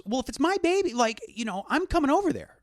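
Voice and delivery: high-pitched, acted protest; insistent father. Father insisting he's coming over for his baby, mock-indignant. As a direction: push back with worked-up determination.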